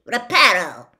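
firm, loud spell-casting; firmly. A narrator's reading. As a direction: cast it with calm authority. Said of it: "said firmly".

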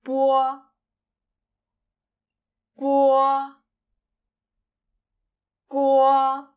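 "bo" said slowly and clearly with a puff-free b and a rounded o; careful teacher demo of "bo"; Mandarin syllable bo. Shown as a demonstration. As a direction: clear classroom "bo". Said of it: "The first initial is".

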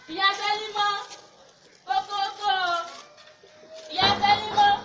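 calling out. Acted. Evangelist knocking for a neighbour, calling out.

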